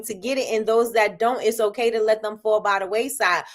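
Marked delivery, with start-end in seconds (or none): none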